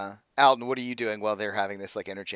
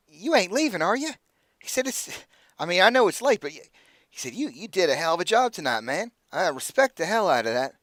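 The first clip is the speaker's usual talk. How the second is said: put-on higher, jovial good-ol'-boy voice; pitched-up friendly NPC, sorry to see him go; Aldo, higher; 6 semitones higher than usual. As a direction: coax him to stay, full of praise.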